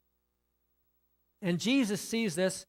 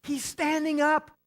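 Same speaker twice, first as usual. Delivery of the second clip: high, loud cries of amazement; the crowd breaking out in astonished joy; amazed mourners; 6 semitones higher, 4 dB louder than usual. Retold or imitated. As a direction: mourners stopping their wailing to exclaim. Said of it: "and they're going".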